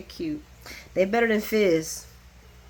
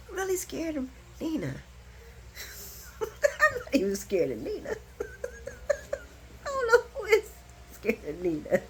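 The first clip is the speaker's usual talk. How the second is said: laughing while talking; 7 semitones higher, 8 dB quieter than usual. Real, not acted. Laughing about Nina.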